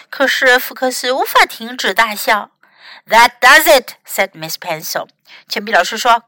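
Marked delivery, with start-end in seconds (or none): 3.1-3.9 loud storybook telling-off; mildly acted exasperation with extra volume; fed-up scolding. A narrator's reading. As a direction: put your foot down, loudly. Librarian loses patience with Fox.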